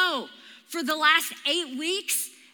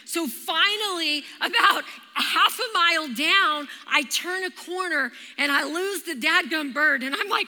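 normal talking voice

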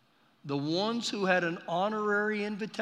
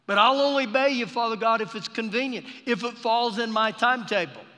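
louder, quick, bargaining voice; a half-hearted believer setting terms; mock self-serving bargain; 6 semitones higher, 7 dB louder than usual. Preacher mocking a believer who obeys only when convenient, mock bargaining.

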